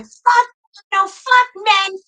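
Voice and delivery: retold angry outburst, high and loud; high-pitched, exasperated swearing; upset outburst. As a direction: curse out high in re-enacted fury. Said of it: "I was so upset".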